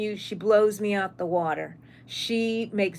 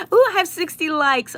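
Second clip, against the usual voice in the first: excited squeal; 9 semitones higher, 7 dB louder than usual. Real, not acted. Sees sixty likes on live.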